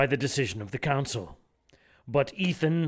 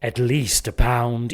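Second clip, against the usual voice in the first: slow, savoring, louder threat; slow relish; 5 dB louder, slower than usual. Acted. Villain promising to take his pound of flesh. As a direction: draw it out with relish.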